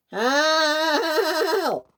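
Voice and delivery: high, long wail for help; calling for help. Acted. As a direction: cry out in despair. James stranded again.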